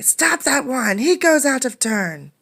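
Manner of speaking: high, loud furious yell; angry yelling. Acted. Woman at the mill yelling angrily at Condi. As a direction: shout him down from the queue. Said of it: "The woman began yelling angrily".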